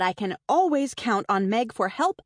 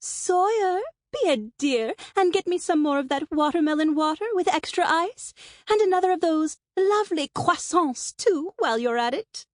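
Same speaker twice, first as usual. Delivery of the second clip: high, affected sing-song call; put-on sing-song diva summoning someone; fake sing-song; 7 semitones higher than usual. A narrator's reading. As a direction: lilt it sweetly and falsely, pitched well up. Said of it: "Angelica's fake sing-song voice calls out".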